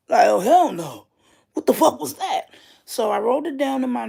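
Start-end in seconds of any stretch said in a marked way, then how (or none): 0.3-2.4 startled cry, higher and louder; freaked out. Retold or imitated. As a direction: jolt awake horrified, almost crying out. Waking up scared from dream.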